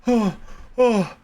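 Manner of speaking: high, wheezing, pained laughter; laughing through pain. Real, not acted. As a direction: laugh so hard it hurts. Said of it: "oh God, my rib hurts".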